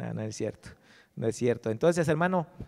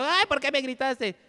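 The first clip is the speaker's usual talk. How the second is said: much higher, whining complaint of a hurt wife; whiny wife; 11 semitones higher, 3 dB louder than usual. Retold or imitated. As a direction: play the offended wife, high and plaintive. Wife scolds husband for yelling.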